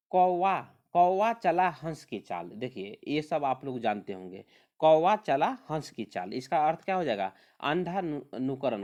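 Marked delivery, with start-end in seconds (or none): 0.1-2.5 slow and loud, each word weighed; proverb recital. Shown as a demonstration. Teacher reads the proverb to students, slow and loud. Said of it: "लोकोक्ति क्या दिया हुआ है". 4.8-6.3 slow and loud, each word weighed; proverb recital. Shown as a demonstration. Teacher reads the proverb to students, slow and loud. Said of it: "लोकोक्ति क्या दिया हुआ है".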